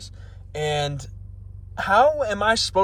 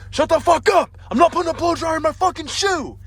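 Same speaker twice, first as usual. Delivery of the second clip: high, loud mock-enraged yell; imagined furious outburst; 11 semitones higher, 4 dB louder than usual. Snapping at girlfriend over blow dryer idea, furious high yell. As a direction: blow up at her, voice shooting way up. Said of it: "in my head I was like that".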